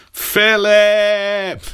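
high hammed-up shout of the name; mock cry of rage. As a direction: bellow the hated name in melodrama. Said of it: "And he's like".

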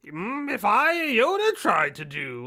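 high, strained, hammed-up Yoda voice; terrible Yoda. Shown as a demonstration. Co-host shows what a bad Yoda sounds like, high and hammy. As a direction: squeeze out a deliberately bad Yoda. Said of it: "that's a terrible Yoda".

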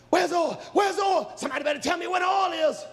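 high, urgent shouting; acted desperate yelling; frantic demand. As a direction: shout it repeatedly, speeding up at the end. Girls frantically demand the oil.

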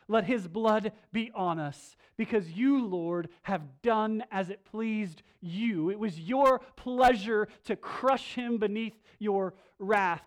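slow, loud pleading prayer to the Lord; loud, slow prayer of confession; fervent prayer. Shown as a demonstration. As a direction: pray it out with full force, slowly. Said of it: "we say".

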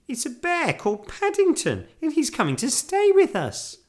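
put-on very high, loud excited girl; eager Judy. A narrator's reading. Girl introducing bear to housekeeper, eager.

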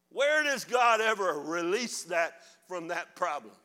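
loud, outraged pulpit questions; preacher rails against sin; fiery preaching. Acted. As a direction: hammer the questions at the congregation.

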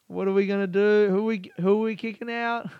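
high, loud eager question; excited guy. Retold or imitated. Man asks who to vote out, imitated excited shout. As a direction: pump it up like a hype man. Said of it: "And then he's like".

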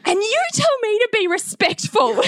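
very high-pitched mock outrage; accusing child. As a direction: imitate a wounded kid throwing it back. Son's accusing outburst acted out.

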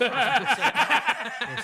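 loud laughing yeah. Real, not acted. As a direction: burst out laughing.

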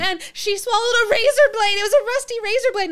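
high-pitched mock-frantic child; frantic little girl. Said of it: "she's frantic".

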